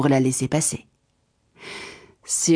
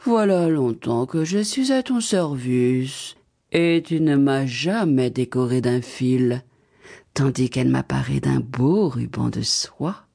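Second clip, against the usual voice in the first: put-on slow, lower voice of an old tree; slow birch tree; 4 semitones lower, slower than usual. The birch tree reproaches Baba Yaga, slow low tree voice. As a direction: drag out the complaint like an ancient birch. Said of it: "dit le bouleau".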